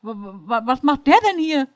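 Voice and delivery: sputter, then burst out high and loud; stammering in shock. A narrator's reading. Jenny panics about the fan aboard, stammering loudly.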